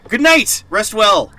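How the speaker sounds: high, loud farewell shout; bright goodbye called to the chat; cheery call-out. Real, not acted. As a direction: send the raiders off warmly. Calling farewell to the raid.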